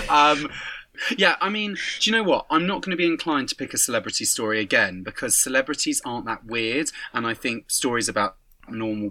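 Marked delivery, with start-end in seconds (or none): none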